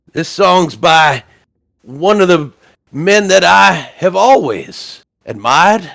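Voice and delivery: slightly hammed-up, folksy stage patter; folksy bard showman. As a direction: introduce a tune like a proud traveling performer.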